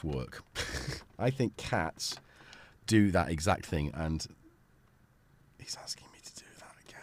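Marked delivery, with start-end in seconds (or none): 5.6-7.0 fast, voiceless whisper; whispered aside. Real, not acted. Streamer confiding that he's being pestered, hurried whisper. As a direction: whisper it quickly under your breath.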